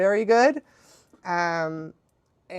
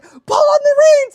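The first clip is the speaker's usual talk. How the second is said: high, loud mock command; urgent shouted order; 8 dB louder than usual. Little friend coaching the scared rider, high urgent yell. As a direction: shout instructions across to a panicking rider. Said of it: "talking to me like this".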